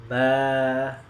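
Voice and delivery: "ba" released cleanly with no nasal hum; clear open ba. Shown as a demonstration. Teacher shows students a clear "ba" after release. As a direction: say "ba" with the lips opening crisply, no nasal sound. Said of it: "sudah tidak mampet lagi".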